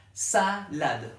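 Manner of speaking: "Salade" in two separated beats, sa, lade; two syllables. Shown as a demonstration. Teacher confirming the child's answer, syllable by syllable. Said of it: "Ok, deux syllabes".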